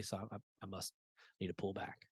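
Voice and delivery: quiet. Retold or imitated. Retold despair, very soft.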